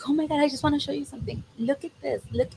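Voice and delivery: high, fast, breathless rush of excitement; quoting her gushing to show him something; excited gush. Retold or imitated. Carla on the roof urging him to look, fast excited gush.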